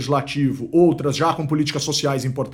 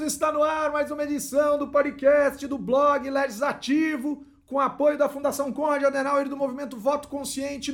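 show host intro; 10 semitones higher, 4 dB quieter than usual. Acted. Podcast opening announcement.

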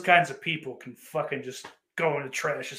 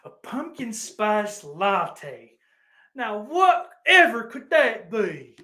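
sarcastic wonder; 6 semitones higher, 4 dB louder, much slower than usual. Pretend.

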